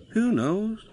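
high, sighed question; sighing. A narrator's reading. Douglas answering worried Toad, sighing. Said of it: "sighed Douglas".

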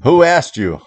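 high, loud, irritated retort; exasperated holler; hollering back. Acted. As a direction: yell it back at the owl. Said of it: "I hollered back".

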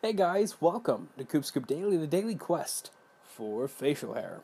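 upbeat, high channel greeting; vlog intro. Acted.

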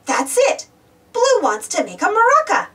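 usual speaking voice

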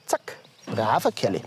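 low, soft praise for the pony; low soft praise for the horse; tender praise. Real, not acted. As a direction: coo a reward to the horse. Praising the horse after lifting.

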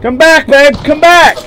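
high loud cries for help; desperate calling out. Real, not acted. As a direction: plead for rescue loudly.

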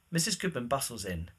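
ordinary talk, nothing marked

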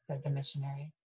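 plain reading voice

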